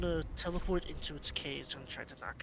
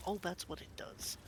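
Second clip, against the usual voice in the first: ordinary talk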